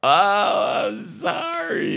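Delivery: high, loud hammed-up sorry; mock wail apology. Real, not acted. Caught skipping the story, mock wail. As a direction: cry out sorry playfully. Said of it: "you did this to me".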